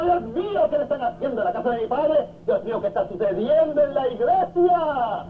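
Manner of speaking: put-on high, fast, desperate outcry of the older brother; older brother shrieking at the party in his father's house; desperate cry. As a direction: wail in panic at the noise from the house. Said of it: "empieza a gritar desesperado".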